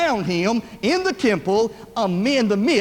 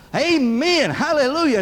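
higher-pitched, mild mock shout of praise; retold shout; 4 semitones higher than usual. Uncle Roy suddenly shouts while sitting around, mildly acted out. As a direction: imitate a sudden joyful church shout. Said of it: "he began to shout".

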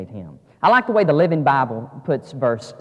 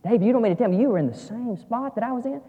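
lightly acted dawning disbelief; astonished Saul; 8 semitones higher than usual. Saul realizing David shared his cave, mildly astonished. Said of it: "He's like".

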